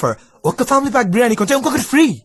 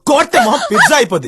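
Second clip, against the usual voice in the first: raised, heated threat; angry threat; 5 semitones higher, 4 dB louder than usual. Acted. Man threatening his date as the quarrel peaks, raised angry voice. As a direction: throw the threat at her in anger.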